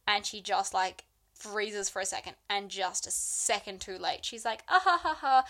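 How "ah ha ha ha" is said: fake nervous laugh. Lou faking a nervous laugh.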